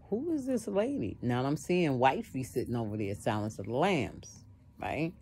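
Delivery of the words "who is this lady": high, puzzled "Who is this lady?"; inner voice. Retold or imitated. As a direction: ask yourself in a much higher voice. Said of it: "I'm saying to myself".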